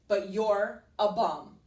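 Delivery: loud, slow punch on "bum"; emphatic rejection. Acted. Princess dumping the prince, "bum" hit hard. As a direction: hammer out "bum" word by word.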